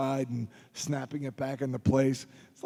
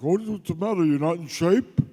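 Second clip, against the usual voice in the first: gruff trainer; 4 semitones higher, 4 dB louder than usual. Retold or imitated. Trainer mocks tired student.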